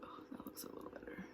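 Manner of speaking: quick soft whisper. Real, not acted. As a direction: murmur it while doing makeup. Whispered makeup chat.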